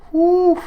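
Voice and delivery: high, loud whoo; excited whoo. Acted. Streamer imagining the writers going crazy, excited whoo. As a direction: let out a loud, high-pitched whoop.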